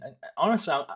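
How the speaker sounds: normal talking voice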